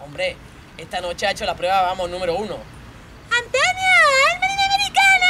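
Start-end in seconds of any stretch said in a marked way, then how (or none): 3.3-5.3 very high squealed call; shrieked cheer. Acted. Cheering Antonio on as number one, shrieking. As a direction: squeal the name out high and long.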